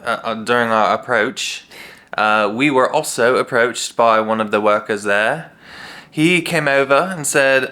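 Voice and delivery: put-on British accent; playful, measured British storytelling. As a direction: tell it in a mock British accent. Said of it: "do it in a British accent".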